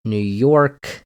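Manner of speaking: ordinary talk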